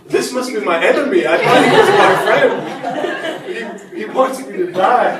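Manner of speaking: loud, high, hammed-up outrage; indignant patient. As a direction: cry out as the comically betrayed patient. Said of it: "this patient was like".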